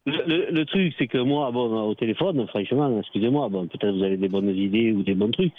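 usual speaking voice

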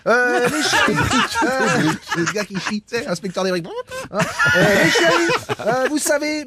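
high, loud hyped TV host; Cyril Hanouna impression. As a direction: hype the crowd like a talk show host. Imitation de Hanouna. (imitation de Cyril Hanouna exubérante)

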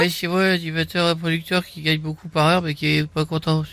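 Arthur impression. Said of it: "je vous passe Arthur".

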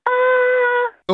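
"Ah" lancé très haut et aigu; son aigu. Real, not acted. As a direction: monte le "Ah" tout en haut. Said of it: "Maintenant un A aigu". ("Ah" très aigu)

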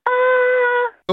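very high squeaky ahh. Pretend. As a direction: shoot the ahh way up. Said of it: "un A aigu". (un « ah » très aigu)

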